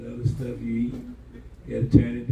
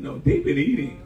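normal talking voice

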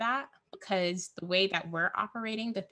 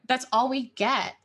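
louder, punchier echo; emphatic repeat; 5 semitones higher, 6 dB louder than usual. Real, not acted. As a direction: say it back with force.

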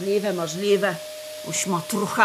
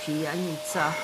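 contemptuous mutter; 7 semitones lower, 9 dB quieter than usual. Acted. Cleaner scorns the drunk.

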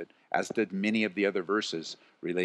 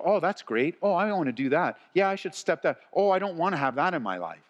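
Bible reader's reactions; 8 semitones higher, 3 dB louder than usual. Acted.

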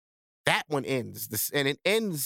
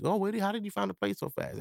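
higher-pitched imitation of someone taken aback; mock surprise; 5 semitones higher than usual. Ex reacting to him leaving in two weeks, mock surprised. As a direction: mimic a startled, wondering question.

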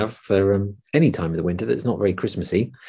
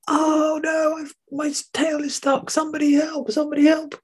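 very high crying rabbit voice; very high panicky rabbit cry; panicked rabbit. Acted. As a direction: wail for help like a trapped rabbit. Rabbit stuck in ice.

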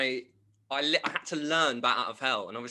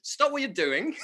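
laughing; 9 semitones higher, 8 dB louder, much slower than usual. Retold or imitated. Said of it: "And they were like".

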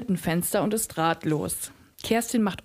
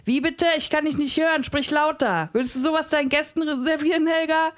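much higher, louder and fast, yelling at her; screaming chef; 8 semitones higher, 3 dB louder than usual. A narrator's reading. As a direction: bark the question at the trembling cook. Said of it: "Frank Rosin schreit so sehr".

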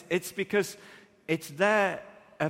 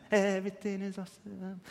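put-on childlike awe; 3 semitones higher, 5 dB quieter than usual. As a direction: hush it like a wide-eyed child. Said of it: "kids have this just".

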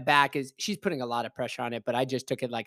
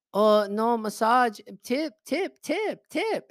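high, insistent mock Chinese accent, climbing as it repeats; imitating Chinese-accented old lady; 7 semitones higher, slower than usual. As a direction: nag for money in a put-on Chinese accent. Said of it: "she kept on saying that".

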